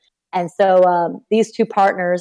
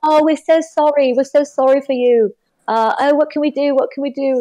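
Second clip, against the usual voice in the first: put-on higher-pitched, botched Australian accent; bad Aussie accent; 7 semitones higher than usual. Australian friends console her on losing her job, in a bad Aussie accent. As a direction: attempt Australian and land nearer British. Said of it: "my Aussie accent is shit".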